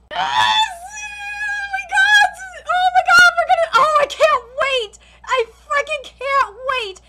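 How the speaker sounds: high, loud yelling with laughter; excited scream. Real, not acted. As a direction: shout your hype at the screen. Hype at upcoming match.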